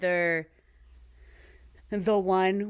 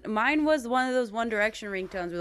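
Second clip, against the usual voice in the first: ordinary talk, nothing marked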